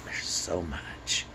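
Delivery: slow, voiceless and soft; a slow breathy marvel; whispered awe. Real, not acted. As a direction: whisper it in wonder, slowly. Awed by books.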